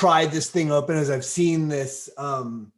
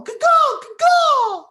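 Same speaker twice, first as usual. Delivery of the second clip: high loud crow caw; bird cry; 8 dB louder, much slower than usual. Acted. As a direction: call out like a crow. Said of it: "I'll do a bird cry".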